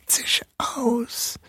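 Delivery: whispering mouse king rising; hissing mouse king. A narrator's reading. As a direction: rise into a shrill hissing whisper. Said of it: "zischte er".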